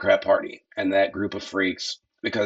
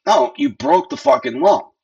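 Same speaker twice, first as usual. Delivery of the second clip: louder and higher, angry; angry retort; 11 semitones higher, 6 dB louder than usual. Real, not acted. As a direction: bite it off. Snaps back at the quoted protester.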